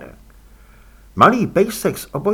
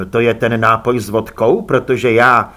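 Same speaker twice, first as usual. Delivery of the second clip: louder, on a level pitch; mild objection; 3 semitones lower, 6 dB louder than usual. Acted. Guest questions the drink his hostess offers, mildly objecting. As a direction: cut in firmly, breaking off unfinished.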